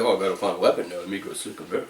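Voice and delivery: low hushed sneaky mutter; sneaky assassin voice. Retold or imitated. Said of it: "that's your voice".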